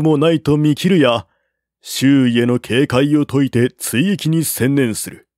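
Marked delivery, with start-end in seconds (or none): none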